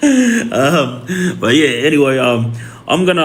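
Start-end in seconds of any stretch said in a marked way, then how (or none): 0.0-1.1 big high burst of laughter; amused laugh fading out. Real, not acted. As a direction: crack up at your own joke. Laughs at Prime Minister joke.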